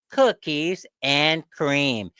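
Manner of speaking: slow, dragged-out "cookies and cream"; drawn-out punchline. Real, not acted. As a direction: stretch every word with disdain. Punchline of the ice cream rant.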